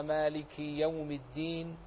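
ordinary talk, nothing marked

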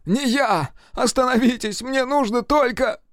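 high-pitched desperate shout; shouting in protest. A narrator's reading. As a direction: shout to stop them, voice pushed high. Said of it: "закричал Серёжа". (отчаянно кричать)

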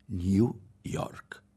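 "New York" said the English way, nu york; a show-off saying "New York" in English; English pronunciation. Acted. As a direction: pronounce "New York" as in English, not Nuova York. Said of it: "Disse così".

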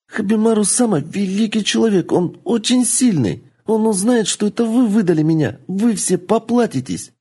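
put-on a child's high shrill warning; high desperate threat. Kitsune warning the madam her master will avenge her, high and desperate. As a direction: frighten them with her master's name.

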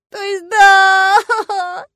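very high, loud, hugely stretched wail; sobbing cry, last word drawn out; wailing correction. A narrator's reading. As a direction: bawl it with a tearful howl. Heroine wails her corrected answer.

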